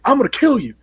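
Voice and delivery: high-pitched mock threat; Godzilla. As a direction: squeal out playful monster hatred. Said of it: "he is just looking at Kong".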